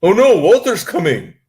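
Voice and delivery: put-on high, loud, alarmed British kid; hammed-up British schoolkid dreading a bully; bad British accent. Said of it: "attempt at a British accent".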